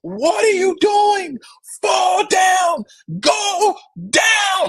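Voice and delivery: high, desperate shouting at the field; frantic yelling. Retold or imitated. Fan in the stands screaming at Dre to go down, high frantic shouting. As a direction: scream at the runner to go down. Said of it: "I am losing my mind in this venue".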